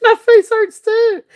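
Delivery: high, slow squeaky words through laughter; real helpless laughter; laughing. Real, not acted. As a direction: barely get it out through the giggles. Laughing so hard her face hurts.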